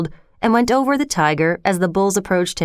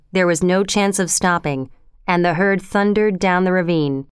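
plain reading voice

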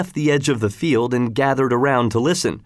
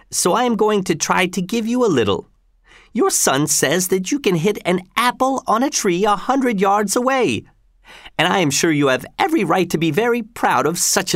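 higher-pitched governor, mock-genial and taunting; governor Gessler; 8 semitones higher than usual. Governor slyly setting up a challenge for Tell, mock-genial and high. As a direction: toy with the man in a lifted voice.

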